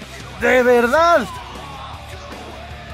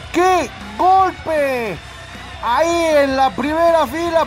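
excited; 7 semitones higher, slower than usual. Real, not acted. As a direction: shout the dive like it just happened. Wrestler dives into the crowd.

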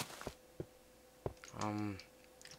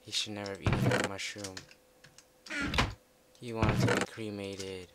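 mock eulogy; 7 dB louder than usual.

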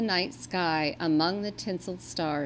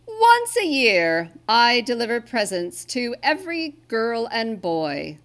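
Santa explaining; 4 semitones higher, 7 dB louder than usual. Acted. Santa explains Christmas to reindeer, loud proud voice.

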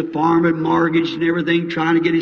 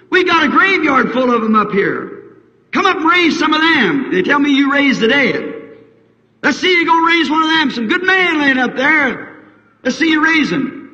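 mocking priest; 8 semitones higher than usual. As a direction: jeer at him to prove it. Priest taunting Jesus.

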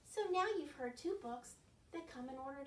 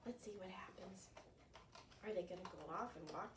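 hushed suspense whisper; 6 semitones lower, 12 dB quieter than usual. Acted. Reading to kids, hushed suspense whisper. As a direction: lean in and breathe it.

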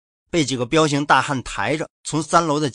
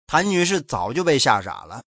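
plain reading voice

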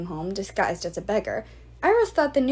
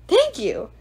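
louder, higher call; 9 semitones higher, 6 dB louder than usual. Acted. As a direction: call back smugly. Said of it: "called Hippias".

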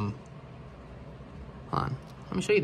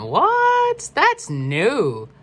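surprised; 12 semitones higher, 5 dB louder, much slower than usual. Real, not acted. Notices an unexpected new feature.

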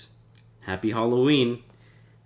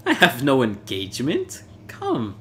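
impatient Fortunato; 4 semitones higher than usual. Acted. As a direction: wave off the excuse. Fortunato brushing off excuses.

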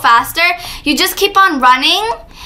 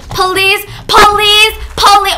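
raised, insistent begging; urgent plea, higher and louder; pleading; 6 semitones higher, 3 dB louder, much slower than usual. Real, not acted. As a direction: beg louder with each word. Begs players not to fall.

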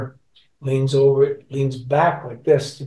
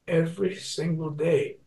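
higher, softer, lightly choked-up retelling; imitated choked-up voice; 4 semitones higher, 5 dB quieter than usual. Emotional fan telling the singer about his song, mildly choked up. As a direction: mimic him getting choked up, understated. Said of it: "he got choked up and he went".

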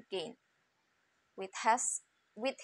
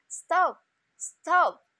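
loud, high urgent cry of stop; the sparrow yells a warning to the carter; calling out; 8 semitones higher, 8 dB louder than usual. A narrator's reading. Sparrow shouting at the carter to stop, loud and high. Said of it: "called out".

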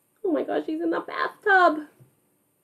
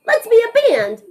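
eager suggestion; 8 semitones higher, 4 dB louder than usual. Acted. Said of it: "said Bert".

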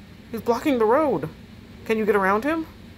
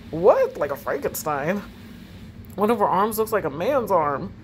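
usual speaking voice

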